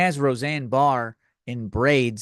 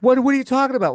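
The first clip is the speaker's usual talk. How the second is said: incredulous; 12 semitones higher, 6 dB louder, much faster than usual. Retold or imitated. Woman reacts in disbelief.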